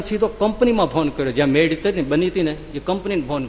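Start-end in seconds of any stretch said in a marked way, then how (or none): none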